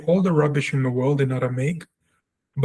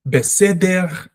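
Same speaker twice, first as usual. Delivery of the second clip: slow, higher demo of Israeli accent; Germanic-style Israeli Hebrew accent; 5 semitones higher, slower than usual. Shown as a demonstration. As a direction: draw it out with an Israeli guttural R. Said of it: "That's the Israeli accent".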